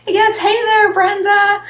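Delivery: high, loud delighted hello; light up as she spots a friend; greeting viewer Brenda, bright and loud; excited greeting. Real, not acted. Greeting a viewer who joined.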